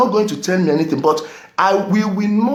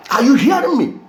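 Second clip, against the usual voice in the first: forceful demand; 6 semitones higher, faster than usual. Real, not acted. Ranting at audience.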